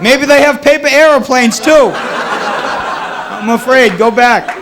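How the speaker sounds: a fake Russian, scared and pleading; mock Russian accent. Russian sailors fleeing a sailboat, in a mock Russian accent. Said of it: "the authentic Russian I did for you".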